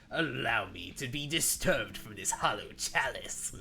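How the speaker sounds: hoarse, mildly voiced villain lawyer; hoarse Jeremy Irons voice. Streamer recalling the antagonistic lawyer, in a mildly hoarse haughty voice. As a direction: rasp through a haughty lawyer's speech. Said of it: "making my voice hoarse".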